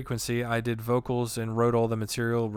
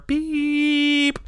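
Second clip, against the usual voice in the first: high loud mock beep; beep imitation; 9 dB louder than usual. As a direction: squeal out a machine beep. Answering machine beep imitated.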